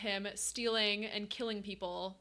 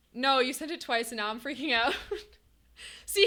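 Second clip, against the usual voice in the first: ordinary delivery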